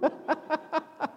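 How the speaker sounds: high bursting laugh; real burst of laughter. Real, not acted. Teller cracking up at the shopkeeper's reply, high laugh.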